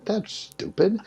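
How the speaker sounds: high-pitched mock scoff at a silly idea; a lightly voiced cocky young student; scoffing younger self. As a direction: scoff it like a know-it-all student. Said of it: "I said".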